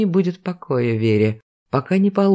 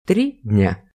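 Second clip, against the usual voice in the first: stern; 4 semitones lower than usual. Acted. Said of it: "строгим голосом объявил срок".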